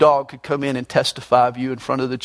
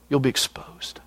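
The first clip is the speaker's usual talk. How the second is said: hushed, softer warning; ominous whisper; 2 semitones lower, 7 dB quieter than usual. Acted. Pastor warns liars in a soft, ominous whisper. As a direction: whisper it like a threat.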